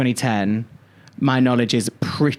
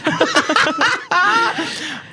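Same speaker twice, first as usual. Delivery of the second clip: high giggle. Retold or imitated. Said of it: "I'm trying to do Brummy accent".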